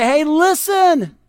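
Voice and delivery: high, drawn-out call for attention; eager proclaiming. Acted. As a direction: call out like a shepherd with news. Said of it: "be like these shepherds and just say".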